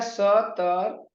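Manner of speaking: स तर spelt out slowly, sound by sound; sounds separated. Shown as a demonstration. Teacher spelling out a word's parts, slow separated pronunciation. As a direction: leave a gap between the sounds in स तर. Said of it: "सभी वर्णों को मिला करके".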